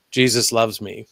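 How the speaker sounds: usual speaking voice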